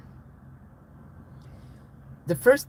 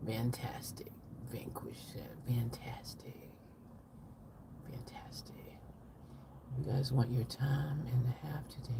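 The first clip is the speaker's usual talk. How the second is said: whispering; 3 semitones lower, 10 dB quieter than usual. Acted. Streamer whispering a boss's warning to listeners, whispering.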